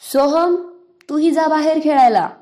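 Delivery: loud, high call across the house; mother calling out. Acted. Mother calling her son to go out and play, loud call. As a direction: send the boy out to play with a shout. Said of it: "त्याची आई म्हणाली".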